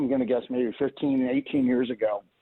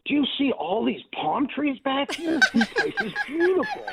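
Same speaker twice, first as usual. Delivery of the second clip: imitating Ronnie. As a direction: mimic a dreamy, awed Ronnie, much higher. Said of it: "He says".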